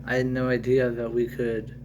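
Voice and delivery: plain reading voice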